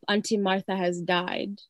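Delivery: ordinary talk, nothing marked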